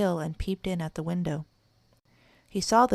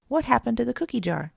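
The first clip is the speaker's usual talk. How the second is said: higher, bewildered question; surprised cry; 6 semitones higher, 3 dB louder than usual. A narrator's reading. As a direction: ask in startled dismay. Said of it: "The three little kittens cried".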